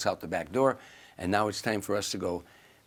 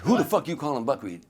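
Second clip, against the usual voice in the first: louder, higher indignant demand; angry challenge; 5 semitones higher, 5 dB louder than usual. Retold or imitated. Drunk Sammy confronting a waiter, acted angry challenge. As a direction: repeat it harder, as an acted confrontation.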